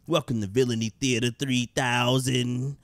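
usual speaking voice

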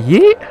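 high, rising "yeet"; mock yeet cry. Shown as a demonstration. Player acting out a throw, high rising exclamation. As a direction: toss it up high like a throwing noise. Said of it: "I gotta be like".

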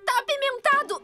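high startled yelp; shocked yelp. Acted. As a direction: yelp at the spicy bite. Bites spicy cookie.

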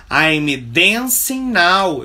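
slow classroom demo sentence; slow teaching model. Shown as a demonstration. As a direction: model it slowly for the class to repeat. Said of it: "Eu estou dançando agora".

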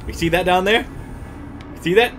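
normal talking voice

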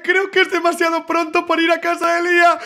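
ordinary delivery

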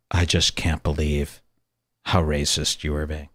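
low, soft, hurt retelling; hushed mock-wounded voice; imitating tearful reproach. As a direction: quietly mimic someone tearing up. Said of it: "literally crying".

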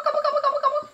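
high, loud gobbling; turkey gobble. Shown as a demonstration. Mother gobbling like a turkey for her toddler.

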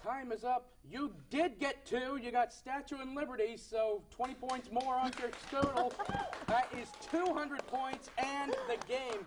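game show host hyping the winner; excited win announcement. Real, not acted. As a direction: sell the victory with lifted energy. Host announces the winning result.

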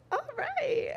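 high, impressed "all right". Retold or imitated. Woman impressed by her partner, high mock approval. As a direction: sound pleasantly surprised. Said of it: "you're like".

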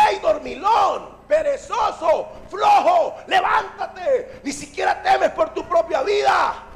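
emphatic, exasperated wake-up call; drawn-out insults in punchy bursts; indignant scolding. Acted. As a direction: scold the lazy sleeper awake. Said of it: "Lo que en realidad trataron de decirle".

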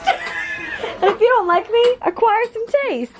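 high, slow, laughing sass; sassy. Acted. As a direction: toss it off with playful sass. Playful sassy retort, laughing.

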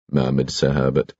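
usual speaking voice

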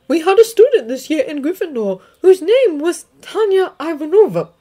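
a stern witch recounting a loss; McGonagall. Acted. McGonagall tells of the student.

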